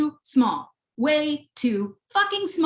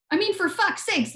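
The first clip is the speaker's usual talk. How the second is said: ordinary delivery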